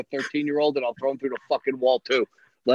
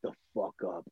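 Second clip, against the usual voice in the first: much softer and lower, grumbled to himself; muttered under breath; 6 semitones lower, 16 dB quieter than usual. Retold or imitated. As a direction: mutter it so the kid can't hear. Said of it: "I'm just like".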